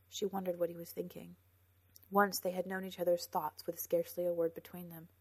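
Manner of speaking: ordinary delivery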